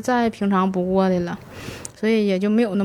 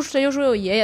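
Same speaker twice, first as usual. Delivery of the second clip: usual speaking voice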